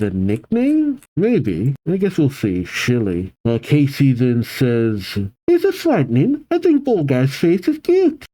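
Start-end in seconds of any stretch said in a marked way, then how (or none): 5.5-8.2 high-pitched put-on girl's voice; Scottish girl. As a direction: voice a cheeky young Scottish girl. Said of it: "Should I make her voice aggressively Scottish?".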